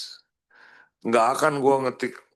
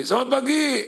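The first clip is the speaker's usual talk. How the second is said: high, bright "Selamat pagi"; lively greeting; 12 semitones higher than usual. Shown as a demonstration. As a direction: sing out the morning greeting. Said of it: "Mungkin nggak flegmatik". (sapaan pagi yang ceria)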